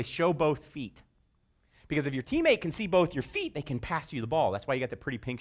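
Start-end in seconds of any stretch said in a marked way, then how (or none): 0.0-1.0 slow and deliberate, each word spaced; slow instruction. Shown as a demonstration. Coach teaching girls to show both feet, slow and clear. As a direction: spell it out for small kids. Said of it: "We say".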